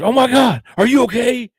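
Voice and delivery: high, loud alarmed cry; alarmed exclamation. Retold or imitated. Homeowner shocked at the pizza guy by his door, high alarmed cry. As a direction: shriek it like a startled homeowner. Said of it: "Like that".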